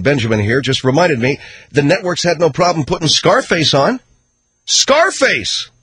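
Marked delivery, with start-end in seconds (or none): 4.6-5.7 exclaimed title. Real, not acted. Host outraged at Scarface airing.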